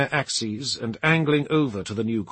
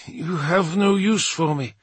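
wounded Dark Angel; 6 semitones higher, 3 dB louder than usual. Acted. Ormond's wounded held voice.

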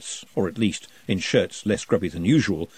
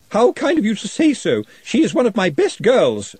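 higher and louder, tight false courtesy; forced politeness; 11 semitones higher, 6 dB louder than usual. A narrator's reading. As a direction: housekeeper fake-sweet. Housekeeper answers through teeth.

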